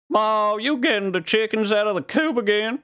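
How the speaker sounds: yokel voice. Acted. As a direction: holler like a farm kid. Put-on hick voice.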